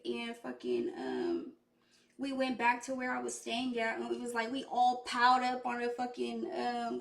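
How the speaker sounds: normal talking voice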